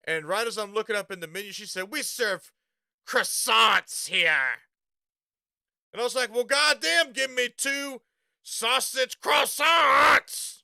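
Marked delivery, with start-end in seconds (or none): 1.9-4.6 very slow, drawn-out haughty croissants; mock-posh cashier correcting a trucker; snotty cashier. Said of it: "Everyone's real fucking snotty". 6.3-10.6 higher, louder, dragging out sausage croissants; fed-up trucker yelling his order; exasperated shout. Retold or imitated. Exasperated order to snotty cashier.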